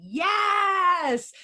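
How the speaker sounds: high, loud delighted yes; a real burst of glee; excited yes. Real, not acted. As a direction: pounce on the answer with delight. Hears her chosen word adaptability.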